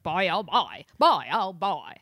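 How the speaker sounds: mocking catchphrase impression, accent wavering; Joe Pesci. Co-host parodying the actor's ever-changing accent, mocking impression. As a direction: waffle between accents on each repeat. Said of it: "'cause I'm Joe Pesci".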